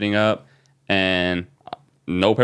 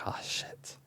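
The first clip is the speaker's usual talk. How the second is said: whispered curse, almost no voice; shocked whisper; 21 dB quieter than usual. Retold or imitated. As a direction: breathe it out in shock, barely voiced. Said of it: "And I'm like".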